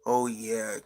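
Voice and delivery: ordinary talk, nothing marked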